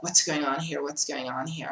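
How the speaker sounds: ordinary delivery, nothing marked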